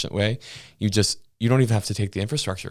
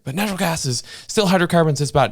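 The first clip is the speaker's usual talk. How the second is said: mocking commenters; 10 semitones higher, 4 dB louder than usual. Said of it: "they're like".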